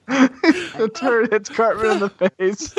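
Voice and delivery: high, slow, laughing through words; giggling squeaky voice. Real, not acted. Laughing retelling cartoon.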